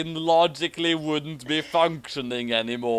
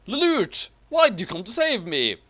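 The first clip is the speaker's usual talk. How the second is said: high, pleading call to a friend; calling out pleadingly; 6 semitones higher than usual. Acted. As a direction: call his name high and desperate. Suzaku calls to Lelouch.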